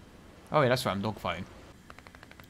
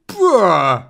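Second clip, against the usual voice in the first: loud falling bruh; drawn out in disbelief; exasperated bruh; 5 semitones higher, 11 dB louder than usual. Real, not acted. Reacts to lost spin.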